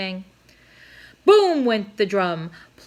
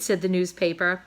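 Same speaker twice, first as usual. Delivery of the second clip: ordinary delivery, nothing marked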